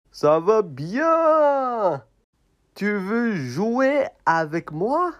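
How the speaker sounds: a small friend begging to play; high puppet voice. Acted. Puppet asks the tired elephant to play, in a high voice.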